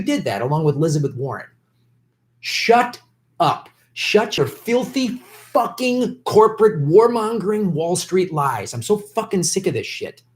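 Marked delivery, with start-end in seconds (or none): 2.4-8.7 raised pitch, slowed, spitting contempt; angry outburst. Real, not acted. Streamer yelling at the debate on screen, angry outburst. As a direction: snap it out like you're fed up with the screen.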